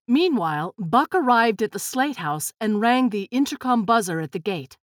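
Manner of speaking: usual speaking voice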